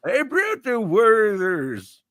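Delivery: put-on slow old man voice; drawn-out old geezer greeting.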